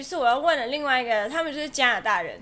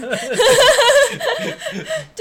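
high, loud burst of laughter; 12 semitones higher, 11 dB louder than usual. Real, not acted. Laughing at distrust joke.

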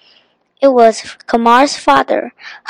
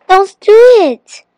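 high, loud call to stop; 9 semitones higher, 7 dB louder than usual. A narrator's reading. Biff tries to stop Flappy barking, calling out. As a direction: call it out sharply to the dog. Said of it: "called Biff".

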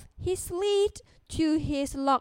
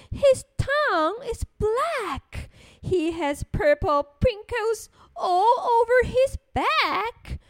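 higher, wide-swinging storytelling voice; animated story narration; 6 semitones higher than usual. Acted. Storyteller describes the terrible creature to small children, animated. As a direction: read to children with big emphasis on key words.

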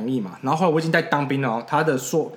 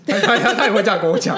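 higher, louder laughing blurt; 8 semitones higher, 7 dB louder than usual. Real, not acted. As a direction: laugh it out in disbelief. Laughing about his girlfriend.

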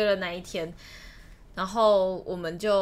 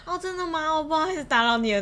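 flustered retreat; 7 semitones higher, 4 dB louder, faster than usual. Retold or imitated. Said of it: "有一点落荒而逃".

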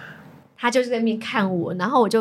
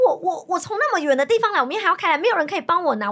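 higher-pitched, stammering retold plea; pleading complaint; 7 semitones higher than usual. Customer telling clerk she came from far away, mock pleading. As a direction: plead your case, half laughing at yourself. Retelling her plea to staff.

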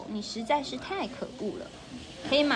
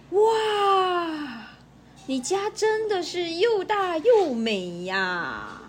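amazed wow; 7 semitones higher, 4 dB louder, much slower than usual. Acted. Country mouse amazed by city house.

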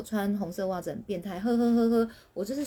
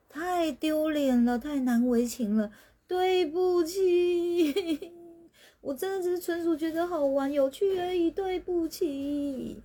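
much higher, slow drawn-out embarrassed apologies with a laugh; mortified; 9 semitones higher, slower than usual. Real, not acted. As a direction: wince and beg forgiveness, laughing at yourself. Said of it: "我那个心超愧疚的".

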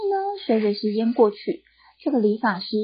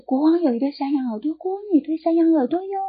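lightly sung, higher flute voice; reed flute voice; 8 semitones higher, faster than usual. Acted. Reed flute playing the secret to the king, sing-song.

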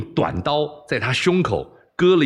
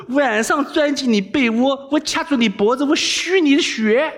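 defiant taunting threat; 10 semitones higher than usual. Acted. Captive boy dares the princess to kill him, high spooky threat. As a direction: taunt her, then spook her like a vengeful ghost.

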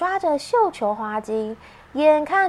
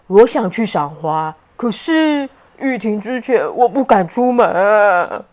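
low character voice; 3 semitones lower, 6 dB louder than usual. Acted. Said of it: "欧奇说道".

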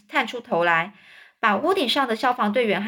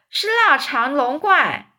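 slow loud cry; drawn-out loud shout; 5 semitones higher, 4 dB louder, slower than usual. A narrator's reading. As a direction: yell it slowly. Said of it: "消防队长也大叫".

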